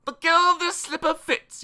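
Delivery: high, ringing princely proclamation; hammed-up princely cry. A narrator's reading. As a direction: declare it high as a theatrical prince. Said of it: "he cried".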